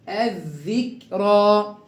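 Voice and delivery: الذكرى slowly with a held double th as in "this"; slow recitation. Shown as a demonstration. Teacher drills the sun lam, slow recitation. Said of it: "كيف نقرأ هكذا".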